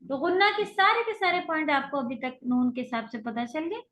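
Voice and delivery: usual speaking voice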